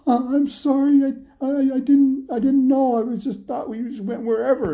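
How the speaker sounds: very high, loud, stammering plea; panicked apology. Acted. Lady scolded by the dog master, high panicked pleading. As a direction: squeal out a flustered apology.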